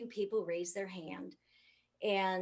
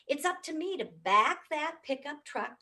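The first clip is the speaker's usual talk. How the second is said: normal talking voice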